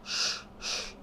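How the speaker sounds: unvoiced "sh, sh"; soft sibilant shoo; shooing chickens. Shown as a demonstration. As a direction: hush out two shooing hisses. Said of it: "kayak lagi ngusir ayam".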